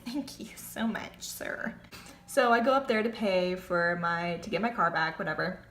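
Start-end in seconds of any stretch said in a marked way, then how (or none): 0.0-1.7 breathy sarcastic whisper; whispered thanks. Real, not acted. Thanking the staring man as he leaves, whispered. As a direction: whisper it with a wry laugh.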